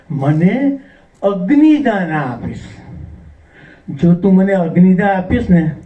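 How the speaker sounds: usual speaking voice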